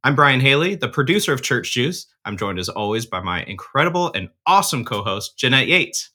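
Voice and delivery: podcast intro read. Acted. Host welcomes church communicators to the podcast, upbeat announcer read. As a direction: sell the show to the listeners.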